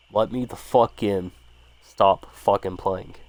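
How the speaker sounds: usual speaking voice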